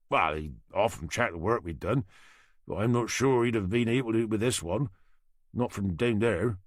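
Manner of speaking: a Norfolk boatyard man answering the inspector; thick Norfolk accent. Acted. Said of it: "he said with a thick Norfolk accent".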